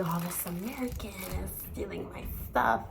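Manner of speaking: hushed, whispered mock accusation; suspicious whisper. Teller voicing a seatmate's suspicion of her, whispered. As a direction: whisper it as his secret thought. Imagines French passenger's suspicious thought.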